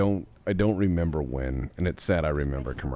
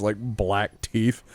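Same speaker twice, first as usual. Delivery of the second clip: ordinary talk, nothing marked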